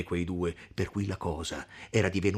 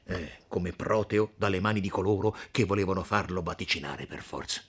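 ordinary delivery

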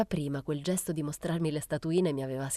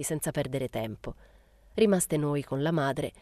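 plain reading voice

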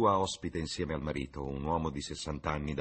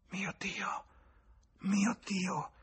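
whispered gasp rising to a high cry; stunned exclamation; much slower than usual. A narrator's reading. James realising his mistake, exclaims in shock. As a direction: horror breaking out of him. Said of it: "esclamò".

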